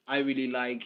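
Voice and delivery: ordinary talk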